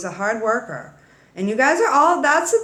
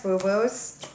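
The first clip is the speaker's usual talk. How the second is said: usual speaking voice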